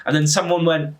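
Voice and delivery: ordinary talk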